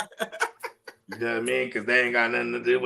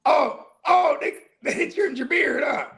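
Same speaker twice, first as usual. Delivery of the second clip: much higher, hammed-up teasing voice; mock doctor; 12 semitones higher, slower than usual. Friend retelling a movie doctor scene, high teasing voice. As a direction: tease in a squeaky mock voice. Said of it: "And he's like".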